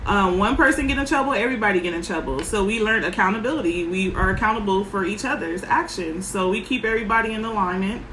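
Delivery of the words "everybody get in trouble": ordinary talk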